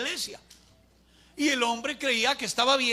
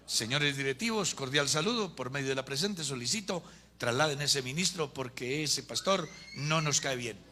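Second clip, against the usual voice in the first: low, subdued recital of a complaint letter; mock formal letter; 7 semitones lower, 4 dB quieter than usual. Congregation writing to church directors to remove a pastor, mock formal. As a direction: dictate it drily, lower than usual.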